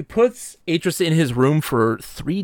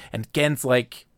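ordinary delivery

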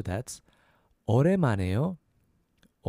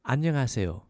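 안녕하세요 joined up at natural speed; a little faster; much faster than usual. Shown as a demonstration. Teacher showing the formal hello, a little faster. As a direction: run the syllables of 안녕하세요 together. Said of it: "A little faster, that's".

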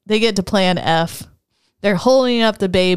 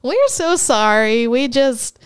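apologetic aliens; 8 semitones higher than usual. Retold or imitated. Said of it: "And they're like".

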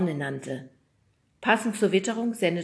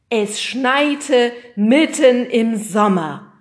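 slow, loud, raised proclamation; dramatic announcement; 6 semitones higher, 12 dB louder, much slower than usual. Acted. Weatherman announces snow in summer, dramatically. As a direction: draw out each word with great drama. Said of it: "verkündete er dramatisch".

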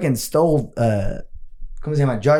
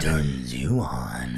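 low, slow, husky echo; sultry radio voice; 13 semitones lower, 7 dB quieter, much slower than usual. Pretend. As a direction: purr it like a seductive DJ. Echoes the radio slogan.

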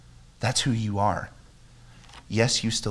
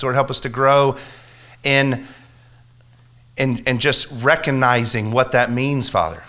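fervent public prayer, building louder; impassioned prayer; 7 dB louder than usual. Real, not acted. Preacher leading the church in closing prayer, impassioned. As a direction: pray out with rising force and urgency.

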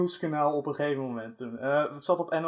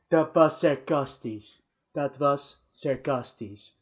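slow, drawn-out mock excuse; retold excuse; slower than usual. Quoting the politician's excuse to his friends, slow and drawn out. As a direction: stretch each word of the quoted excuse. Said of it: "dat hij de dag daarna zo van".

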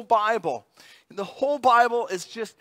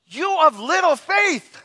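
Jesus rebuking; 8 semitones higher, 6 dB louder than usual. Retold or imitated. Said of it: "You know what he says to him".

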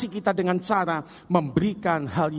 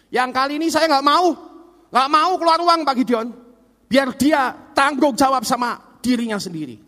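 raised, higher-pitched voice of a father refusing; fed-up father; 4 semitones higher, 4 dB louder than usual. Acted. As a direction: play an exasperated dad putting his foot down. Father refuses to pay again.

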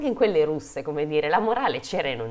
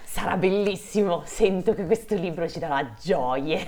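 higher, put-on delighted voice; mock enthusiasm; 3 semitones higher than usual. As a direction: gush with pretend delight about the book. Mock enthusiasm after a grim tale.

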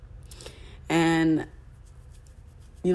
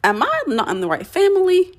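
incredulous disbelief; 12 semitones higher, 4 dB louder than usual. Retold or imitated. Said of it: "And I'm like".

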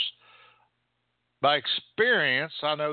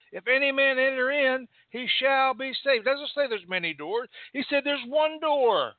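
much higher, ringing pulpit proclamation; fervent preacher building to a peak; full-force preaching; 9 semitones higher than usual. Acted. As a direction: proclaim the verse to the whole church.

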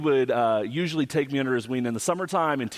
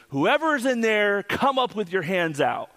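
higher-pitched mock challenge, mildly raised; retold startling command; 7 semitones higher than usual. Homeowner confronting an unknown intruder, retold startling command. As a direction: imitate a startling order to come out. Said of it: "a loud, startling voice".

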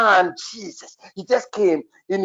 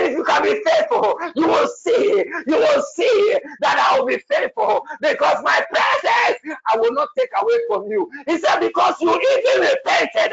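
higher, crying, building prophetic shout; fervent prophecy; 10 semitones higher than usual. Real, not acted. Prophet delivering God's word to the apostle, crying fervent shout. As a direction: plead it with rising fervor.

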